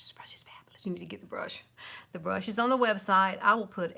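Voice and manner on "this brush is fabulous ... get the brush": confidential whisper to the viewers; whispered aside. Real, not acted. Plugging a favorite brush to viewers, whispered aside. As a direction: drop into a soft, secretive whisper.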